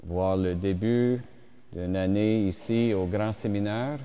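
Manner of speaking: usual speaking voice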